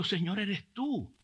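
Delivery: mildly tearful address to God; retold tearful surrender. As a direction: breathe out a retold realisation, slowly. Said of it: "yo estoy llorando".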